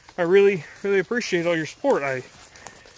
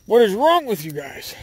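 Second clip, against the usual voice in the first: raised, louder, bewildered parent; mock exasperated scolding; 5 semitones higher, 5 dB louder than usual. Dad to kids with endless energy, mock exasperated. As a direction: act out a frazzled dad's outburst.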